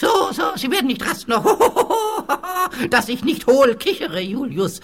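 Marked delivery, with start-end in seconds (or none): 0.0-1.5 mocking. Ghost mocks the warning. 1.5-2.8 hollow chuckle. Acted.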